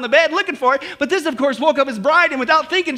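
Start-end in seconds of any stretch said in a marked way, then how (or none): none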